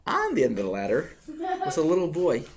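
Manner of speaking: usual speaking voice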